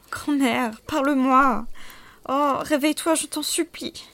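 high, desperate pleading; pleading moan. A narrator's reading. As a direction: beg her to wake, close to tears. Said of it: "Ne pus-je m'empêcher de gémir". (supplication gémissante)

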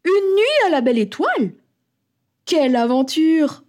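high, thrilled mother; delighted exclamation. A narrator's reading. Mother answers the idea of sleeping outside, delighted.

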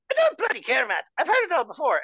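high, loud Irish mom dismissal; Irish mother. Retold or imitated. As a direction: brush it off as an exasperated Irish mother. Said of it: "she speaks just like".

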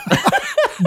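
high, sudden laugh at the slip; high burst of laughter. Real, not acted. Laughing at his own word slip over the foam, a high burst.

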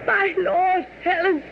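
high, strained, gasping dying cry; strangled voice. Acted. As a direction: choke the words out, high and desperate. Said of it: "in a strangled voice cried out".

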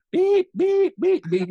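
high, loud mock censor beeps; censor bleep. Joking to the host, loud high censor beeps. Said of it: "You're gonna go".

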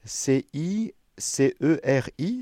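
C I C E R I spelled slowly letter by letter. Shown as a demonstration. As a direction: spell out C I C E R I, one letter at a time. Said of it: "c'est son nom de famille". (épeler lentement C I C E R I)

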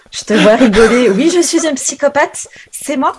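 high, loud burst of joking self-mockery; amused mock confession. Real, not acted. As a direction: own up to it loudly and high, half laughing. Amused mock confession after listener laughs. (aveu amusé, voix haut perchée et forte)

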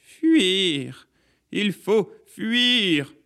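high, drawn-out warning cry; urgent high voice, long falling vowels; rising cry of alarm. A narrator's reading. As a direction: wail it out like a warning. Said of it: "de plus en plus forte".

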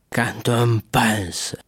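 very slow, heavy tipsy drawl; drunken musing. Acted. Drunkard lays his hand on the paper, musing slowly. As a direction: drag it out like a drunk. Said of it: "dit Caderousse".

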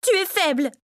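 high-pitched, falling insult; angry accusation. A narrator's reading. Victoria berates her son as weak, angrily accusing.

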